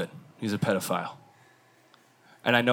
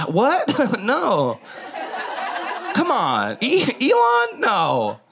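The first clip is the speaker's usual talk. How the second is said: high, shocked squeal of denial; mock disbelief; 12 semitones higher, much slower than usual. Fan denying the news about Elon, high shocked squeal. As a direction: gasp out the name in disbelief. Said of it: "most of you are like".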